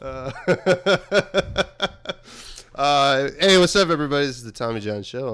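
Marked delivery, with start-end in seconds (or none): 0.4-2.7 big burst of laughter; loud laugh. Real, not acted. Host laughs at guest's mouth. 2.8-4.2 high, loud host greeting; loud show intro. Real, not acted.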